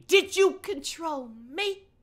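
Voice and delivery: high, slow, hammy wail; interrupting girl. Acted. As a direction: draw out the questions in overwrought high voice. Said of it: "her turn to interrupt me".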